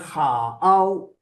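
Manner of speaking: 好 split into two drawn-out sounds; question tone. Shown as a demonstration. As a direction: pull 好 apart into ha and ao. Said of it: "it's question tone".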